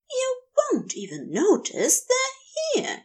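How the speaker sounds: high, sing-song mimicry of his lover's words; mock quoting Crowley. A narrator's reading. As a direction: throw his own words back at him, mocking. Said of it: "you said".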